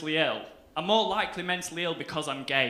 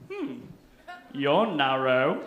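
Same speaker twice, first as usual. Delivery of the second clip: mock seductive; 3 semitones lower, 3 dB louder, slower than usual. Sizing up the banister.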